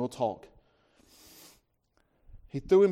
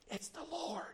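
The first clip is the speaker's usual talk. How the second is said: whisper; 13 semitones higher, 15 dB quieter than usual. Acted. John leans over to Peter.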